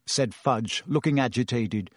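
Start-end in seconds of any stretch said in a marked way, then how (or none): none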